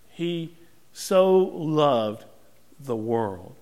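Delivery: drawn-out emphasis. Real, not acted. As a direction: let each word hang in the air. Preacher savours the key verse.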